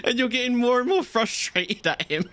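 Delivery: high-pitched, barely holding back laughter; laughing. Real, not acted. Telling Sav how frustrated she got, laughing. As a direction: talk through real giggles. Cracking up retelling the dream.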